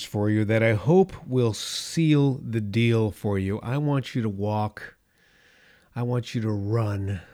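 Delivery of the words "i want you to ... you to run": normal talking voice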